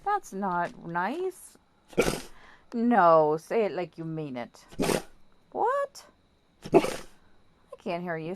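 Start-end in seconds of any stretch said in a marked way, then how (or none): 5.5-8.3 mock surprise. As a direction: pretend you can't hear the dog. Mock not hearing dog's bark.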